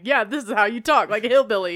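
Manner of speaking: loud mock Texas hillbilly accent; imitating a Texan, loudly. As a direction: put on a hammed-up Texan twang. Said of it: "Texas accent to somebody in California".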